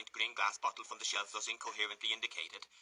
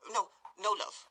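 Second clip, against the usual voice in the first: high, louder hasty denial; 11 semitones higher, 4 dB louder, slower than usual. Acted. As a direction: cut in quickly. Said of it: "interposed the lady hastily".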